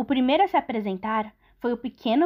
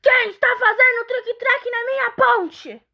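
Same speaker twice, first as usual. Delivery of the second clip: much higher, loud furious troll; angry troll; 11 semitones higher, 4 dB louder than usual. Acted. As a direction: rage at the intruder like a troll. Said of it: "O troll estava tão zangado".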